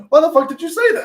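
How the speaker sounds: high, loud, incredulous mock outburst; exasperated outburst. Storyteller replaying a reaction to a refused coffee, exasperated. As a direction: retell it as an exasperated demand. Exasperated retort in a retold conversation.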